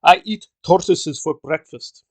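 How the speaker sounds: slow, mock thick East European accent. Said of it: "this East European with a very thick accent".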